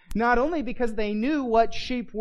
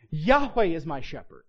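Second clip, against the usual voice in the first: "Yahweh" leaned on, "is my shepherd" falling away lower; stress on Yahweh; 4 semitones lower than usual. Shown as a demonstration. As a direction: put the weight on the name "Yahweh", then drop. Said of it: "emphasis, it probably would have been something like this".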